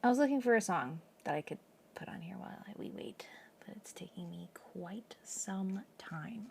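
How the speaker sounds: whispering. Real, not acted. Whispers to chat.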